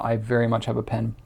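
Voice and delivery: normal talking voice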